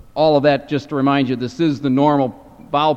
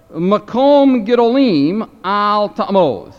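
slow scripture reading; 4 semitones higher, 5 dB louder, slower than usual. Shown as a demonstration. Reads the Hebrew text aloud.

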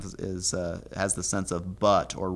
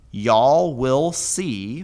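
"y'all will see" read very slowly, each word drawn out and a bit louder; slow translation read; 4 semitones higher, 6 dB louder, much slower than usual. Shown as a demonstration. Teacher glossing the Hebrew for students, slow and deliberate. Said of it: "now the Vav consecutive perfect introduces the main clause".

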